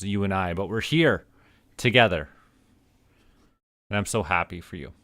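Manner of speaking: usual speaking voice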